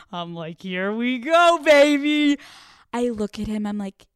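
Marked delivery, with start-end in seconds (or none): 0.6-2.4 loud, rising inner cheer; gleeful hype. Retold or imitated. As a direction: belt it out, climbing higher into the last word. Gleeful inner cheer as lie works.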